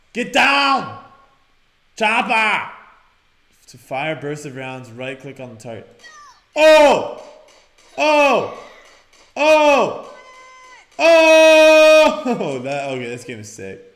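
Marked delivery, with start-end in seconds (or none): 0.1-2.7 loud, high battle shout; shouted warning. Real, not acted. Calling out during combat. 6.6-12.6 repeated loud, high "oh" shouts; excited yells. Real, not acted. Yelling while firing in game.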